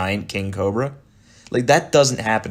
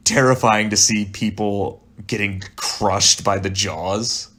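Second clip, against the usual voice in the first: ordinary delivery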